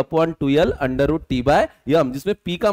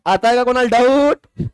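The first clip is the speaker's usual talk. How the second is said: raised, stretched-out call to the room; 6 semitones higher, 4 dB louder than usual. Real, not acted. Teacher asking the whole class for doubts, calling out.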